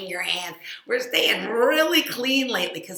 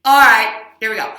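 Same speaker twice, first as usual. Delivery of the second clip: normal talking voice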